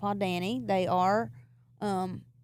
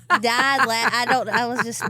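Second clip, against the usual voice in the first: usual speaking voice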